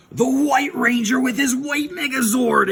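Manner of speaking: high, loud triumphant announcement; mock-epic fanfare voice; announcer reveal. Storyteller unveiling the hero from the shadows, mock-epic announcement.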